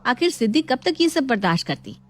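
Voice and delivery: ordinary delivery, nothing marked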